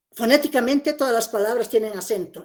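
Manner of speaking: normal talking voice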